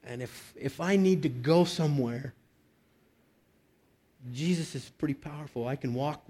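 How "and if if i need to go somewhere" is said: ordinary talk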